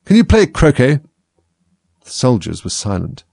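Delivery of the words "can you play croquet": high, loud demand; shouted question. A narrator's reading. Queen demands of Alice, shouting. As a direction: fire the question out commandingly.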